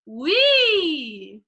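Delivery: bright sung wee. Shown as a demonstration. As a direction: play with a bright edge. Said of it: "brightness would be like".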